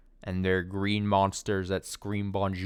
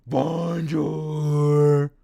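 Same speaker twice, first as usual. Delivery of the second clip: loud, raised drawn-out bonjour; green monster greeting; 6 semitones higher, 7 dB louder than usual. Retold or imitated. Imitating the green monster from the commercial, loud and drawn out. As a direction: hold it long like a cartoon monster. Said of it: "Just a green monster goes".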